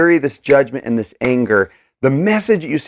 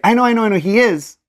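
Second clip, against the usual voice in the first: higher, quick reassurance; quick concession; 7 semitones higher, faster than usual. Acted. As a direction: concede it hurriedly, pitched up. Voicing a placating reply in verse.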